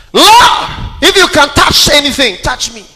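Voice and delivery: high, loud shouted preaching; fiery revival shout; preaching at full force. Real, not acted. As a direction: lift the room with it.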